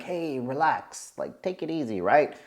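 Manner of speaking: small hushed voice, imitating a timid man; timid pushover. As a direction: play a meek pushover calming someone down. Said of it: "pushover, timid, and he's kinda saying".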